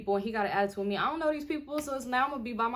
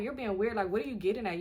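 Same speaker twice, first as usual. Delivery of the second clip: normal talking voice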